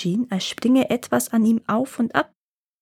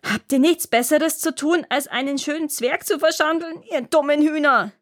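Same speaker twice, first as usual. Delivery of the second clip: high, furious dwarf tirade; 7 semitones higher than usual. Acted. Dwarf rages at the sisters for cutting his beard, high and furious. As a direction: spit out the insult like an enraged little dwarf. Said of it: "wurde er fuchsteufelswild".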